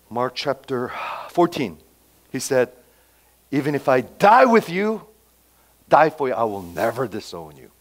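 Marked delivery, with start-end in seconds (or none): 3.5-7.7 retold vow, punching "die" and "never" in "I will never disown you"; emphatic. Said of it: "He said rather emphatically".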